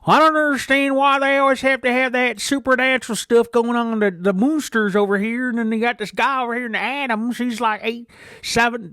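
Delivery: high, loud hollered complaint; loud Idaho camper. Retold or imitated. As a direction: holler it so the whole campground hears. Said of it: "the loud one of the family".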